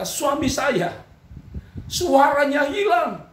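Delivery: high, drawn-out imitation of weeping; mock tearful. Wife telling pastor about her husband, mock crying. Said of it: "Lalu dia menangis".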